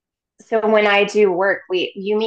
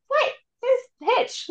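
much higher, mock outraged; half-joking offended gasp at her; indignant disbelief; much slower than usual. Retelling first meeting her own higher self, mock indignant. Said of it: "I was like".

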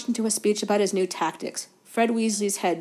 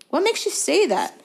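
high, irritated question; testy; 9 semitones higher than usual. A narrator's reading. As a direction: snap back testily. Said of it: "said Wood testily".

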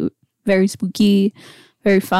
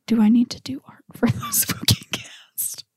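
soft whisper with a laugh; 4 semitones higher, 5 dB quieter than usual. Real, not acted. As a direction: whisper it like a worried aside. Worried aside about drawing art.

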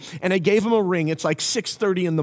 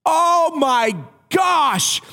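high, loud, drawn-out oh my gosh; dawning shock; 10 semitones higher, 4 dB louder, much slower than usual. Retold or imitated. Friend realizing he's been pranked, slow loud exclamation. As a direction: stretch it out as it dawns on him.

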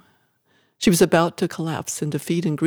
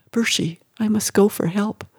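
plain reading voice

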